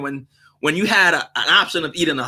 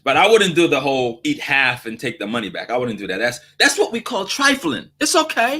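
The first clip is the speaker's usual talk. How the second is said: ordinary talk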